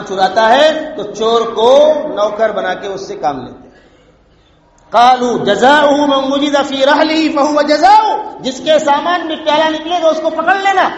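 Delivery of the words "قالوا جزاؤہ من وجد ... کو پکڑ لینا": raised proclaiming voice. Acted. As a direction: ring it out like a ruling. Brothers answer the king's men.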